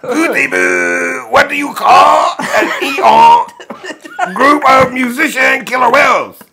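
put-on French accent. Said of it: "Read the joke in a French accent".

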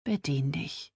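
soft, resigned consent; exhausted. A narrator's reading. Tired sister lets her borrow clothes, resigned. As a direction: give in softly, tiredly. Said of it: "lehnte sich erschöpft zurück".

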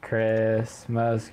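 ordinary delivery

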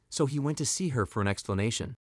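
usual speaking voice

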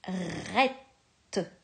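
"Rette" said slowly with an open è; dictated syllable. Shown as a demonstration. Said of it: "tu vas écrire la syllabe".

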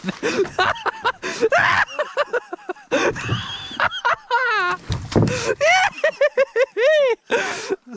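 very high helpless shrieking laugh; shrieking laughter. Real, not acted. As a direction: laugh until you can't breathe.

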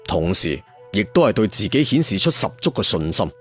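ordinary delivery

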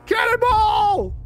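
long drawn-out yell; cannonball yell. Real, not acted. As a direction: yell it as you jump in. Jumping into the green light.

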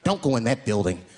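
low, grave warning; hammed-up ominous stranger; mock warning.

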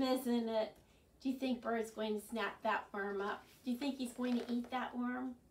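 ordinary delivery, nothing marked

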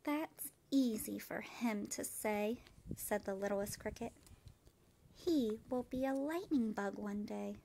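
put-on gentle, even read-aloud voice for children; storybook reading. A narrator's reading. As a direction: read it like bedtime story time. Reads picture book aloud.

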